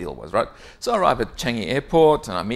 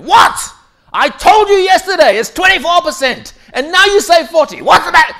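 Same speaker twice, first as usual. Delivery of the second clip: much higher and louder, a raging yell; furious scream; 15 dB louder than usual. Retold or imitated. Boss screaming at manager over a wrong number, furious yell. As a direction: blow up at him like a bully boss. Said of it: "And screams at him".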